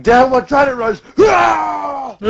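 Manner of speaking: high shouted attack name into yell; hammed-up attack shout; battle cry. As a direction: charge in with an anime war cry. Warrior shouts attack move.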